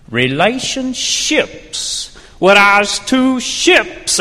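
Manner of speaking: dramatic verse reading; poem recitation. Acted. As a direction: declaim the rhyme grandly. Recites pirate-flavoured poem.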